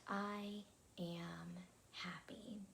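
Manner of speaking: very slow, softened affirmation; meditation affirmation. Real, not acted. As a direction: draw each word out softly for others to repeat. Leads an affirmation.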